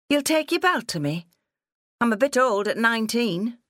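high-pitched, incredulous challenge; defiant daughter. Acted. Daughter scorning father's threat of the belt, defiant. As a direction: dare him, pitched well up.

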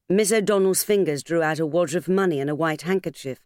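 usual speaking voice

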